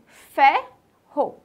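"Ferro" split into fer and ro, loud; syllable by syllable. Shown as a demonstration. Teacher showing children what ferro starts with, syllable by syllable. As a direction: sound out "Ferro" in two separate beats. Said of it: "Ferro começa com o quê?".

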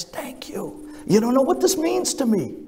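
choked-up thanks. Retold or imitated. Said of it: "and he begins to cry".

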